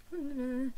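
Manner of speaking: high, tongue-tied little hum; starstruck wordless noise. Retold or imitated. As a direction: imitate a speechless squeak. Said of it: "some kind of noise came out".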